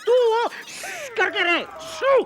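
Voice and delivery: high, sharp shushing outburst; exasperated shushing. Acted. Husband silencing his wife, exasperated high shushing. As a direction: shush her loudly in a high voice.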